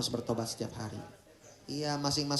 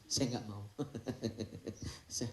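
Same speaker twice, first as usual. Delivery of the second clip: usual speaking voice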